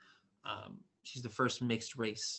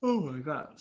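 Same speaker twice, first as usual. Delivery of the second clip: yawning; 5 semitones higher, 7 dB louder than usual. Real, not acted. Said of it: "sorry I'm yawning so much".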